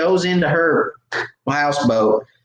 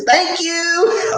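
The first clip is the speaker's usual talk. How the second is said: very high, mock-sweet singsong; taunting thanks. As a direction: taunt her with a falsetto thank-you. Taunts her holding up the fish.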